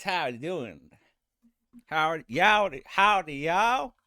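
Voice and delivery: loud, high, drawn-out Southern howdy; high Southern accent. Shown as a demonstration. Streamer showing off Southern accents, loud high version. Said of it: "the high Southern accent, like this".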